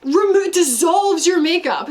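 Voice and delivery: high-pitched retold grumbling; mildly hammed-up irritation; mock angry. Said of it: "he was, like, angry about it".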